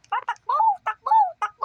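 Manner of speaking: high frantic yell; panicked shout. Acted. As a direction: scream at him to run. Panicked urging to run.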